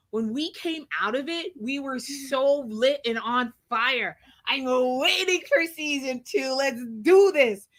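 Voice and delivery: loud, pumped-up pep talk; hyped up. Real, not acted. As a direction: fire the audience up. Hyped about season two.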